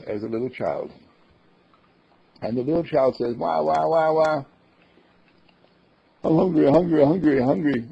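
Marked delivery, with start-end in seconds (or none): 3.4-4.4 mock baby cry, higher; baby wailing. Child crying for food. 6.2-7.8 put-on higher, louder childish whine; whiny child. Little child voiced in story.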